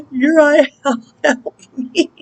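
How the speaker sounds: high, loud call breaking into laughter; hammed-up high plea with a laugh; mock cry for help. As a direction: playfully wail for an angel's help. Calling on angel for help.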